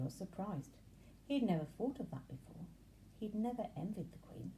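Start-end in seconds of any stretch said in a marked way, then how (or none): none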